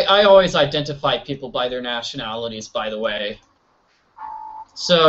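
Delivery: ordinary talk, nothing marked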